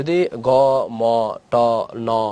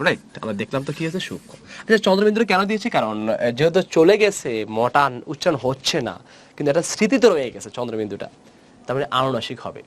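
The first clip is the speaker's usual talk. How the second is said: normal talking voice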